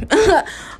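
high, rueful laugh; short laugh. Real, not acted. Ending her story to listeners, a rueful laugh. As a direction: laugh it off after the heavy story.

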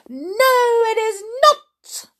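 very high, loud creature shriek; shouting. A narrator's reading. Creature rejecting the first name, shouting. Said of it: "shouted the creature".

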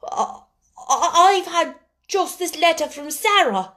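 stunned, faltering schoolgirl; half stunned. A narrator's reading. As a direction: answer dazed, stumbling at the start. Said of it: "answered them slowly, as if she were half stunned".

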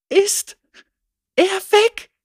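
very high, anxious question; scared girl asking Lucian; timid girl. A narrator's reading. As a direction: ask in a frightened small voice. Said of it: "fragte das Mädchen".

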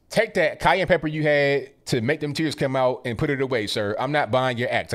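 ordinary talk, nothing marked

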